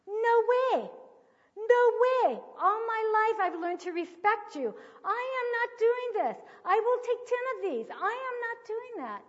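high, loud acted protest; shocked refusal. Daughter refusing to strike her parent, high loud horrified protest. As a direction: imitate an appalled daughter refusing point-blank. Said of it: "Her eyes just bugged out".

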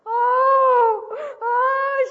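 very high, loud wailed "oh"; imitated crying scream. Daughter made to hit her parent, imitated screaming wail. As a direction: mimic a screaming, sobbing daughter. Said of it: "she's screaming and crying".

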